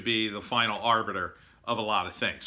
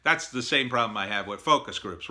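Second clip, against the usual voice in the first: normal talking voice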